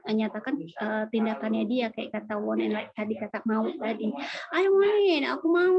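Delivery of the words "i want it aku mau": high, loud whiny craving; eager wanting. Acted. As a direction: gush with exaggerated longing. Acts out wanting something.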